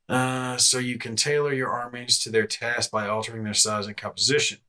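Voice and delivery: ordinary delivery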